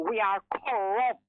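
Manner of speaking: normal talking voice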